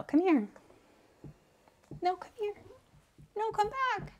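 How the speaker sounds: calling the cat. Real, not acted.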